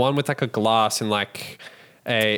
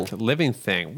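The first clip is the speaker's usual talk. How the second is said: ordinary talk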